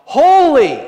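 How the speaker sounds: loud, high full-force shout; shouted warning. Acted. Seraph warning a mortal in God's throne room, shouting. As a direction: bellow it out as a warning. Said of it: "Likely he's shouting".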